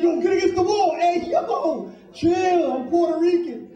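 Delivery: high, loud streetwise shouting; cop stop yelling. Retold or imitated. Retelling being shoved against the subway wall, loud high yelling. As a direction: bark at him to get against the wall. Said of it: "I'm on the train, like".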